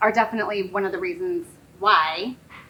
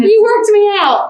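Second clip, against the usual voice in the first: excited; 12 semitones higher, 12 dB louder than usual. Real, not acted. Winded host, laughing exchange.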